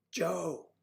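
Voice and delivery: "Joe" starting high and gliding down; "Joe" drawn out with a falling tune; name demonstration. Shown as a demonstration. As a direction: sound out "Joe" as one stretched syllable. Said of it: "you should go like this".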